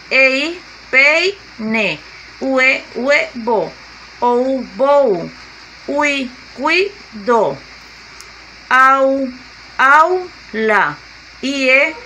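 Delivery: vowel pair held out, then the word in separated syllables; slow syllable demonstration. Shown as a demonstration. Teacher drilling diphthong examples for students, slow and syllabified. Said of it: "Tenemos U A, formamos la palabra".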